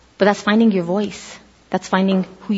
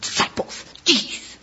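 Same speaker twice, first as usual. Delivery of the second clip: whispered grumble; Jesus muttering about the disciples, annoyed whisper; 6 semitones higher, 8 dB quieter, slower than usual. Acted. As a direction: grumble under the breath going back to bed.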